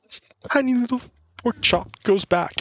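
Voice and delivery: high squeaky tiny voice. Acted. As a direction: make it sound small and cute. Voicing the tiny pork chop.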